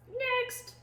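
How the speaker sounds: very high squawked word; parrot squawk. A narrator's reading. Parrot calling the next rascal, high squawk.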